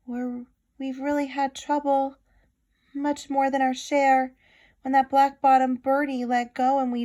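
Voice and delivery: a little voice calling up from a speck; lightly voiced worried mayor; tiny mayor. A narrator's reading. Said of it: "came the voice of the mayor".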